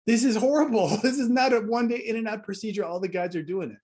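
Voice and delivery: loud, high cry of exasperation, laughing into it; loud laughing dismay; horrified outburst. Retold or imitated. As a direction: blurt it out in disbelief, then laugh at the absurdity. Exasperated at bleeding wound.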